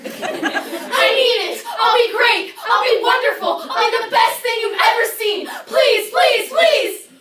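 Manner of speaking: high, loud pleading; frantic plea for any part; desperate begging. Acted. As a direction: beg for the role like your life depends on it.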